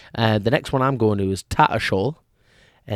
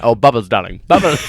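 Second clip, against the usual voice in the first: loud, lightly put-on comedy character voice; a hint of a gushing sketch-show character; Bubbles De Vere; 6 dB louder than usual. Echoing co-host's Little Britain reference, lightly mimicked character voice. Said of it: "Bubbles De Vere".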